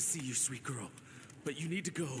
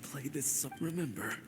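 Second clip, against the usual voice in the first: whispered farewell. Acted. Father whispering final advice to his daughter.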